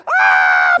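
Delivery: high, loud acted shriek; terrified scream. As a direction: burst into a scream mid-sentence. Screaming again in the fall.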